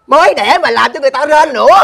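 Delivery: high, loud indignant accusation; outraged scolding. Acted. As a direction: berate your friend at full volume. Phẫn nộ trách bạn. (lớn giọng trách móc phẫn nộ)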